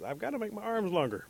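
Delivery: high-pitched, urgent insistence; insistent Rabbit. A narrator's reading. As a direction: plead your case to a doubter. Rabbit insists to doubting Bear.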